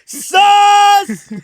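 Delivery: sudden shrill screamed word; wild scream. Real, not acted. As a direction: shriek it out like a madman. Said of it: "that scream was crazy".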